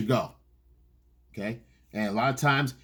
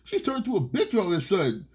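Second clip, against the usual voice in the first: confused guy; 11 semitones higher than usual. Retold or imitated. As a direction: play the clueless guy who doesn't get it. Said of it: "you're like".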